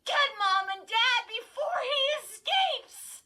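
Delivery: high, loud, slow shriek; shrieking plea. A narrator's reading. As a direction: keep shrieking, desperate. Continues the shriek.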